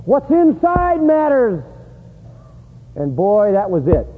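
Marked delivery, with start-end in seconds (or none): 0.1-1.6 preaching prophet. Acted. Preacher voices John the Baptist's message.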